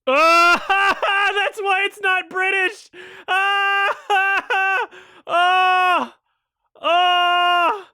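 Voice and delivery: high laughing shout, breaking up; cracking up loudly at the joke; shrieking laughter. Real, not acted. Streamer howling with laughter at a funny line.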